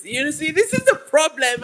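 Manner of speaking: ordinary delivery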